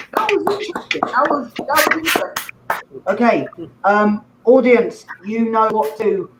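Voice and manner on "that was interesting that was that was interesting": bemused whisper. Real, not acted. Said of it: "host reacts to the joke".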